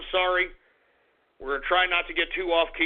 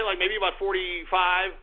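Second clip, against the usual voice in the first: ordinary talk, nothing marked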